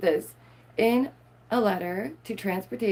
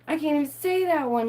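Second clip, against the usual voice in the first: higher, exasperated repeat; 6 semitones higher than usual. Real, not acted. Stumbling over bacteria words, higher exasperated complaint. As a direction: throw up your hands.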